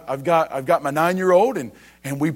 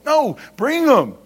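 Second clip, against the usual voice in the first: firm correction; 7 semitones higher, 4 dB louder, slower than usual. Real, not acted. Answers the mock sulk firmly.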